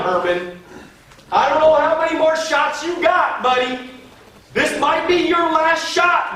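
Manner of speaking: high, loud, urgent coaching; urgent exhortation. Real, not acted.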